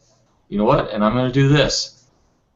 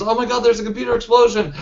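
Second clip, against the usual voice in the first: fast, higher-pitched alarmed cry; mock panic; 9 semitones higher, faster than usual. Game master replaying the player's bluff, mock panicked. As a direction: rush it out as a pretend warning.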